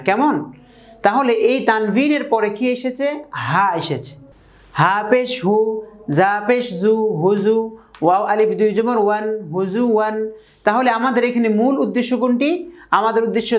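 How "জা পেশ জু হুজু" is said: ordinary talk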